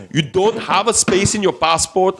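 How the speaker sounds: a hammed-up African officer voice; African accent. Retelling the border officer to friends in a mock African accent. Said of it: "the African accent".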